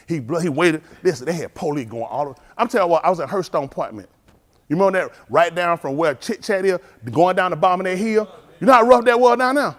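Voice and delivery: ordinary delivery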